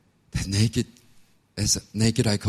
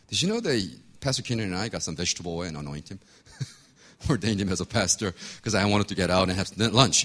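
ordinary talk, nothing marked

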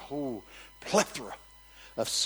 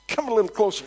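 little preacher; 10 semitones higher, 10 dB louder, faster than usual. Retold or imitated. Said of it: "Little preacher said".

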